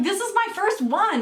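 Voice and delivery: high-pitched retold objection; indignant protest. Refusing to give away a first pick, indignant.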